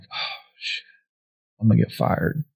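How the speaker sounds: whispered inner thought of being in trouble; hushed dread. Retold or imitated. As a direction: mutter it privately under your breath, sinking. Dreading thought on being caught lagging.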